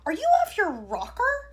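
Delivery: high, loud outburst; cried. A narrator's reading. Wonka crying out at Mike's idea. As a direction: cry out in disbelief. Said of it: "cried Mister Wonka".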